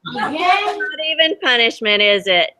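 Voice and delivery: reading a picture book aloud to the class; lively read-aloud for kids; storybook reading. A narrator's reading. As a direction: tell it gently to children, letting the wonder show. Teacher reads story to children.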